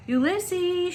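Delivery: high calling shout, held long; shouted name. A narrator's reading. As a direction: call the name into the dark. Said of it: "shouted Flora".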